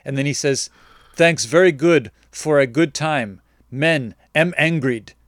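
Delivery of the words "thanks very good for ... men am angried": slow, louder reading of his broken English; mock broken English. Reading the customer's angry text aloud in slow, mock broken English. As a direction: read his clumsy text slowly and deliberately.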